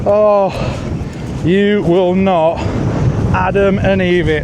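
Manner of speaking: loud, slow and incredulous; amazed. Real, not acted. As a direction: spell out each word in disbelief. Amazed at how fish hooked.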